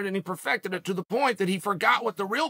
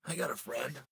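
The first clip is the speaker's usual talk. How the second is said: much lower, much softer imitation of the friend; friend's put-on voice; 8 semitones lower, 14 dB quieter than usual. Imitating a friend's voice for the audience, low and soft. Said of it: "Let's see if you recognize this".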